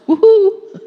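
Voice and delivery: loud, high celebratory woohoo; a whoop of celebration for the listeners; joyful whoop. Real, not acted. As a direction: cheer with full delight. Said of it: "Celebrate that".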